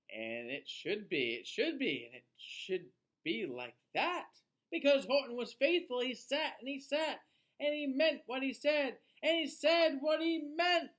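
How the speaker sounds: put-on lively, sing-song read-aloud for children; storybook reading. A narrator's reading. Reading a rhyming book to children, lively storyteller voice. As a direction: read it like bedtime story time.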